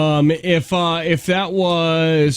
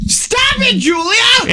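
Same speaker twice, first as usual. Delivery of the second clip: mock shout; much slower than usual. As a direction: yell at the screen playfully. Mock outburst at video.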